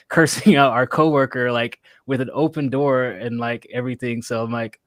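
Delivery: normal talking voice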